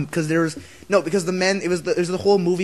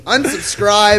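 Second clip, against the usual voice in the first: mock listener outrage; 4 semitones higher, 7 dB louder, much slower than usual. Playing disgusted listener leaving.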